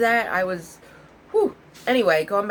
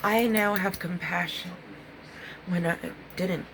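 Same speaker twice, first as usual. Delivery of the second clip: usual speaking voice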